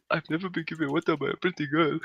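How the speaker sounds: high-pitched imitated Indian accent. Drunk scholar thanks girl for water, high Indian accent. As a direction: mimic an awed drunk man in an Indian accent. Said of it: "in his fucking Indian accent".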